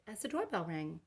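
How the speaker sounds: plain reading voice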